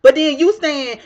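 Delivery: usual speaking voice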